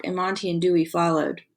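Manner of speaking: ordinary delivery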